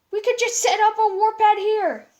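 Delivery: high, loud cheerful Pearl voice. Acted. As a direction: offer the fix brightly.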